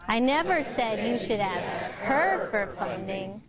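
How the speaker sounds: "her" lifted above the rest; emphasis landing late, on "her"; stress on HER. Shown as a demonstration. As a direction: punch the word "her". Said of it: "changing the emphasis can change".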